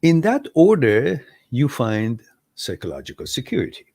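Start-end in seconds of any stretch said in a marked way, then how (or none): none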